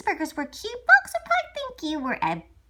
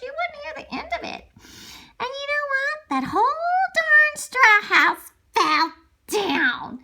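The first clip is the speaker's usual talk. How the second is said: plain reading voice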